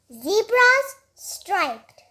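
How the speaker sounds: ordinary delivery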